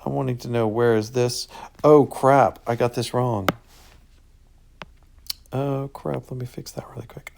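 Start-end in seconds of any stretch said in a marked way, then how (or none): 1.8-2.6 sudden dismay. Real, not acted. Notices own mistake. 6.3-7.3 fast whisper to yourself; mutter-whisper while fixing it; quick whispered aside; hurried whisper. Real, not acted.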